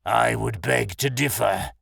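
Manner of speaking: slow, menacing bear voice; drawn-out bear character voice; amused bear. A narrator's reading. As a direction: draw it out as a gloating beast. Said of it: "the bear laughed".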